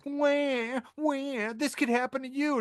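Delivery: high, mock-wailing crybaby whine; whining rich people. Podcaster mocking rich people upset about the tax, high mock whine. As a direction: mimic spoiled complainers in a high-pitched wail. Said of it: "rich people who were like".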